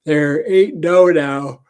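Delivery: talking through a big yawn; words stretched by a yawn. Real, not acted. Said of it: "I keep yawning".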